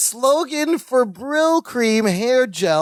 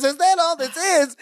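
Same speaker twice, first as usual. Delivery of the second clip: high-pitched indignant mock scream; Balki; 9 semitones higher than usual. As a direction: throw the question back in outrage, without real volume. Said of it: "he's screaming himself into a headache".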